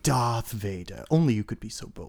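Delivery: a light hint of Leia's British accent; quiet, lightly put-on British accent; mock British Leia. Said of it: "She's like".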